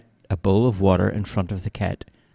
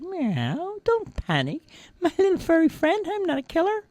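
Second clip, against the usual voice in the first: soft, high cat voice; purring cat; 3 dB quieter than usual. A narrator's reading. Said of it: "The cat purred and replied".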